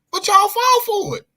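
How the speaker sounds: high, loud, incredulous jab; exasperated outburst. Real, not acted. Fan ranting at GMs, high and loud in disbelief. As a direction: throw up your hands at them.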